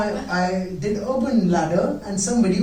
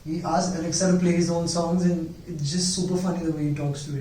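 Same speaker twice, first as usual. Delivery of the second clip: ordinary talk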